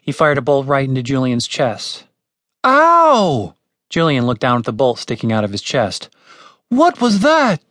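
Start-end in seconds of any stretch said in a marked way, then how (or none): 2.6-3.5 high, loud drawn-out yelp; cry of pain. A narrator's reading. Julian is hit by a bolt. 6.7-7.6 high, carrying startled question; startled shout. A narrator's reading. Shout after the accidental shot.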